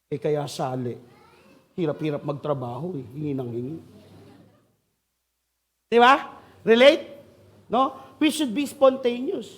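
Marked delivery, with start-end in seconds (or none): 0.1-3.8 grumbled aside. Acted. Stingy neighbor grumbling about beggar, low muttered complaint. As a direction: lower and softer, muttering complaint.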